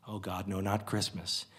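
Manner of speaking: a soft horrified groan of disbelief; quietly devastated, as if the world ends; hushed despair; hushed dismay. Acted. Narrator's dismay, much softer.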